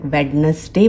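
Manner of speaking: वेडनेसडे as "wed-nes-day"; three-syllable वेडनेसडे; D pronounced. Shown as a demonstration. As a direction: common mistake, sounding the first D in वेडनेसडे. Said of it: "अगर आप अभी तक".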